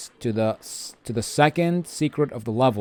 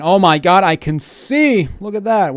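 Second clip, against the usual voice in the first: excited; 7 semitones higher, 6 dB louder than usual. Real, not acted. Reacts to the lit-up area.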